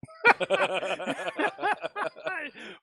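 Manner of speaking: long, high burst of laughter; high-pitched belly laugh; real laughter. Real, not acted. Reacts to whistle joke.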